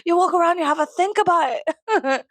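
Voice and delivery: high, loud mock-Scottish scolding; bad Scottish accent. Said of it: "I can't do a Scottish accent".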